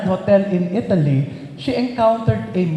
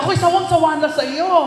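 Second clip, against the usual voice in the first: fed up; 8 semitones higher, faster than usual. Retold or imitated. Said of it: "nangyamot na si Sister Mila".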